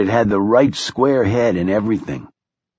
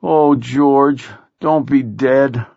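higher, drawn-out plea; grieving moan; 4 semitones higher, slower than usual. A narrator's reading. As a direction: moan and plead with the lost friend. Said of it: "Blaze moaned".

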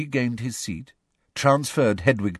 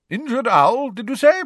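surprised gruff teacher; 6 dB louder than usual. Acted.

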